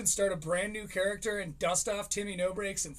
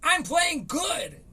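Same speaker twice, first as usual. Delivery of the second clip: put-on slow, much higher kid boasting; bragging kid; 10 semitones higher, 6 dB louder, much slower than usual. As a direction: drag it out like a smug little kid. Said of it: "kid brags".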